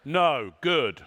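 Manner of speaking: usual speaking voice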